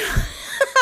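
high airy nervous giggle; nervous laugh. Real, not acted. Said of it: "That's a nervous laugh".

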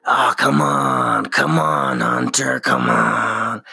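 drawn-out low coaxing; lightly voiced pleading demon; wheedling inner voice. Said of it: "It just".

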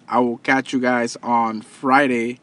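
ordinary delivery

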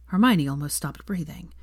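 ordinary delivery, nothing marked

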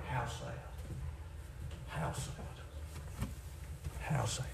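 very soft, sorrowing aside; hushed lament. Real, not acted. Preacher grieving over folks who refuse salvation, hushed lament. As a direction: let it fall away softly, pausing between each.

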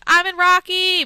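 high, loud excited announcement; imitated excitement. Quoting friend's text about Rocky, high and excited. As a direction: blurt it out like big news.

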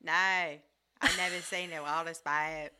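slow put-on Australian drawl; Australian accent. Farmer admits ignorance, in a put-on Australian accent. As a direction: answer like a baffled Aussie farmer. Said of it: "I got the accent".

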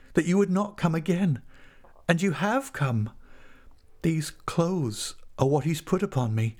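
high-pitched, plaintive woman's voice; bitter, pleading heroine in a high voice; anguished woman. A narrator's reading. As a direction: beg him to leave, voice pitched high. Tess sending Angel away in story.

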